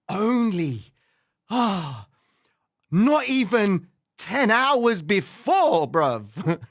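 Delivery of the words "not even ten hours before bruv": slow, louder, higher; incredulous stress. Real, not acted. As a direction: hammer the disbelief home. Incredulous punchline.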